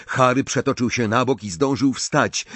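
plain reading voice

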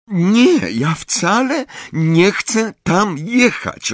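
high, slow, emphatic refusal; a sister flatly refusing, words hammered slowly. A narrator's reading. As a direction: spell out each word firmly. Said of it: "oświadczyła dobitnie Petunia".

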